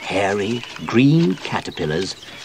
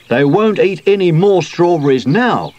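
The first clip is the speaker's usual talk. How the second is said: higher, louder, pleased declaration; proud; 7 semitones higher, 5 dB louder than usual. A narrator's reading. Sooty boasts that the caterpillars are beaten, proudly. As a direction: announce it with pride. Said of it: "said Sooty proudly".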